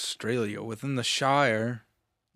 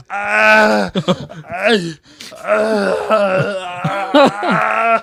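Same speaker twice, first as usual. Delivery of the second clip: hammed-up loud higher screams; imitating Arnold's scream; 8 semitones higher, 11 dB louder, much slower than usual. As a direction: bellow like Arnold in pain. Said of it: "he's just like".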